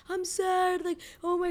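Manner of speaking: high-pitched mock whimper; imitated sobbing child. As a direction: imitate an upset little kid wailing. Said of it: "her son is sobbing".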